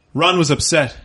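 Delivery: ordinary delivery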